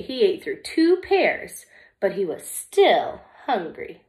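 lively, expressive storybook reading; reading to children. Acted. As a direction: read it like story time for little kids. Reads picture book to children.

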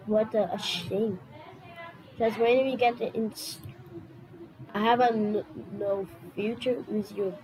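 lightly voiced theatrical villain; dramatic villain voice. Mock dramatic villain speech.

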